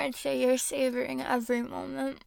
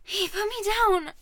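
startled protest; 6 semitones higher, 5 dB louder than usual. Acted. Protests being moved by partner.

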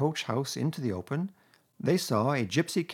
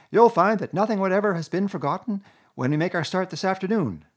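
higher, louder, showing-off Toad; boastful Toad; 7 semitones higher, 5 dB louder than usual. A narrator's reading. Toad showing off supplies to Mole, boastful. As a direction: reel off his treasures proudly.

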